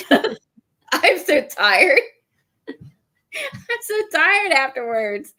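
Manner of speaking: high, slow words through laughter; real giggling, drawn-out complaint; laughing helplessly. Real, not acted. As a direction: laugh at your own exhaustion. Laughing at her own exhaustion.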